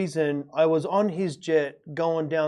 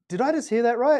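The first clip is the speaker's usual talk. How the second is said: stunned disbelief; 7 semitones higher, 5 dB louder than usual. Retold or imitated. Shocked question to the rich guy.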